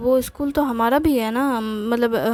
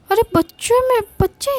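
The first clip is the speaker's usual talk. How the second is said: sudden high, loud cry of surprise; a husband blurting out in alarm; startled protest; 10 semitones higher, 4 dB louder than usual. Acted. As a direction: jump up in pitch, caught off guard. Husband caught out by complaint.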